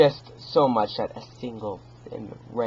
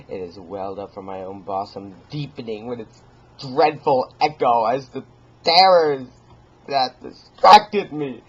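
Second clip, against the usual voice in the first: usual speaking voice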